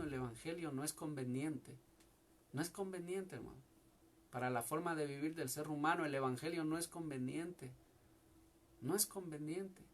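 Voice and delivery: sudden drop to a low, soft voice; quiet. Real, not acted. Bajada íntima del predicador. (en voz baja y suave, casi confidencial)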